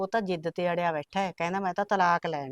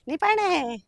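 calling out; 10 semitones higher, 4 dB louder, much slower than usual. Acted. Calling neighbour from outside gate.

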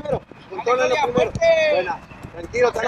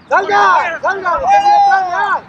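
shouting; 7 semitones higher, 5 dB louder than usual. Real, not acted. Coach yelling from the sideline.

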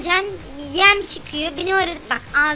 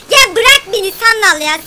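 annoyed yell; 5 semitones higher, 10 dB louder than usual. Real, not acted. Annoyed at game boat.

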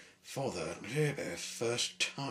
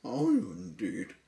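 sniffy; 9 semitones higher, 5 dB louder than usual. Acted. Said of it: "sniffed the person".

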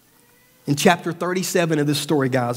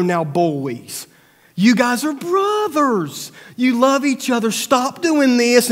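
higher, slow, gently scolding angel voice; lightly voiced TV angel calming brothers; angelic Roma Downey; 7 semitones higher, slower than usual. Angel telling feuding brothers to stop, slow and higher. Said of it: "We would send in Roma Downey".

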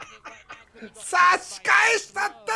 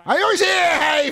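loud excited cheer; high, loud hype shout; hit hard crying out; 12 semitones higher, 9 dB louder, slower than usual. Real, not acted. Cheering a good punish.

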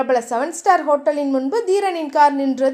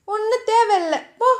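higher, coy playful refusal; affectionate pout; 6 semitones higher than usual. Acted. Diya playfully refusing Theeran's offer, coy and affectionate. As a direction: tease him sweetly while saying no. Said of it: "செல்லமாக சொல்லவும்".

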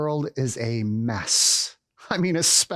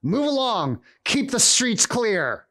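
higher, commanding Roman soldier; mock soldier's shout; 7 semitones higher than usual. Roman soldier intimidating people walking through, shouting. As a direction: order the crowd along in a raised voice. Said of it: "as I shouted to them".

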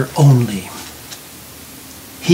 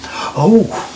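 higher, gasped little "oh"; soft gasp; 6 semitones higher than usual. A narrator's reading. Girl touches Johnny's cold skin, gasping lightly. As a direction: let it out as a startled gasp. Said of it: "then gasped at the touch".

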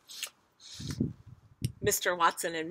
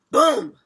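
loud, higher-pitched "boom"; booming crash; 8 semitones higher, 15 dB louder than usual. A narrator's reading. Floor gives way under the bed, loud boom. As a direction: burst out with a big boom. Story sound effect read aloud.

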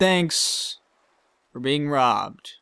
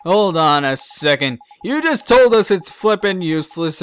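ordinary delivery

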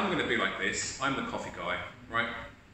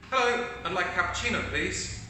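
higher and faster, upbeat order; positive customer; 6 semitones higher than usual. Acted. Positive customer ordering a cappuccino, higher and upbeat. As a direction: greet the barista like a happy regular. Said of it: "show you how ... in a positive way".

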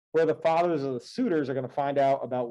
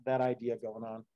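ordinary delivery

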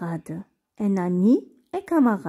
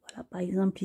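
whisper; 9 dB quieter, much faster than usual. Real, not acted. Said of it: "aside during reading lesson".

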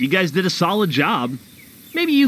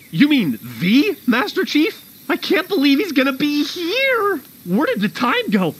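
starstruck, pitch leaping up in disbelief; flustered excitement; 7 semitones higher than usual. Acted. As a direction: get worked up over the big visitor. Flustered over an expected idol's visit.

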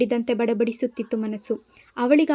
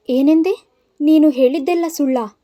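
loud, higher, voice shaking with tears; trembling tearful cry; 4 semitones higher, 6 dB louder than usual. Acted. Mathura to the scheming woman, betrayed: loud trembling cry. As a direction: cry it out in disbelief, voice quivering. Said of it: "ಅವಳ ದನಿ ಇನ್ನಿಲ್ಲದಂತೆ ನಡುಗುತ್ತಿದ್ದರೆ".

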